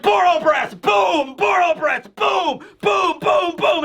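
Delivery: spam each blast with a shout; excited kid firing off attacks, shouting; rapid-fire battle shouts. Retold or imitated.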